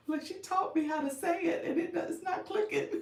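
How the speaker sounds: high-pitched, laughing confession; real laughter breaking into the words; helpless giggling. Real, not acted. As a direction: talk through giggles, voice climbing high. Giggling at her own slip.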